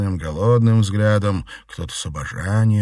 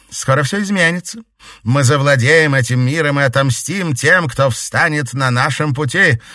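triumphant villain; 7 semitones higher, 6 dB louder than usual. A narrator's reading. Baroness proclaims revenge.

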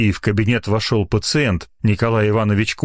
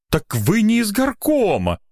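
high-pitched sudden realization; dawning realization; 12 semitones higher than usual. A narrator's reading. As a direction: realize it aloud, pitch leaping. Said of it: "наконец догадался он".

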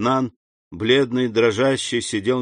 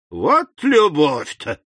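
put-on slow, higher-pitched drunk exclamation; drunken cry; 5 semitones higher, 5 dB louder, much slower than usual. A narrator's reading. Drunk Caderousse marvelling at love, crying out tipsily. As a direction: cry out tipsily, dragging the words. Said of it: "закричал Кадрус пьяным голосом".

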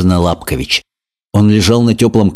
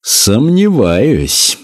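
pompous lizard; 4 semitones higher, much slower than usual. A narrator's reading. Lizard official speaks.

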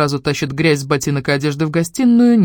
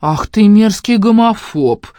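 slow, loud mock indignation; play-acting offended shout; mock outrage; 6 semitones higher, 5 dB louder, much slower than usual.

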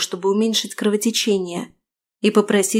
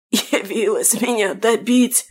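hoarse; slower than usual. A narrator's reading. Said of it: "закашлялся он".

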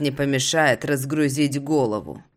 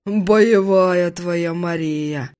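slow, higher amused buddy; friend voice; 3 semitones higher, 3 dB louder, much slower than usual. Acted. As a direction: drawl it admiringly.

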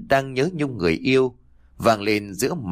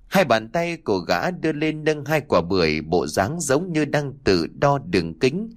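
usual speaking voice

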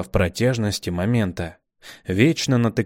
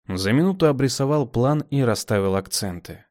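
ordinary delivery, nothing marked

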